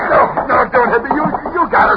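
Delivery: high, panicked begging not to be hit; frightened pleading. Acted. Cornered crook begging the police not to hit him, high and panicked. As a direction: cower and plead for mercy.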